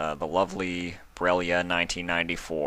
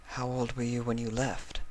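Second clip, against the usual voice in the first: higher, softer voice for the sweet mare; a soft, higher Octavia, stunned; 6 semitones higher, 9 dB quieter than usual. Acted. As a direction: voice Octavia gently shocked.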